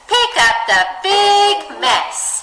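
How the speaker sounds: big said high, loud and slow; dramatic read of big mess; expressive big. Shown as a demonstration. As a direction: stretch the word big out grandly. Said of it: "So it'll be".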